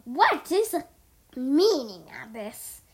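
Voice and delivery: loud, indignant demand; scowling villain. A narrator's reading. Tricked Garmadon demands answers, loud indignant voice. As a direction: demand it slowly as an outraged villain. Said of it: "Garmadon scowled".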